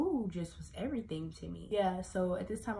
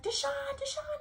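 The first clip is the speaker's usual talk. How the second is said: much higher, urgent calling of a name; retold frantic calling. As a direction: call out for Deshaun in panic. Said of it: "So she's screaming his name".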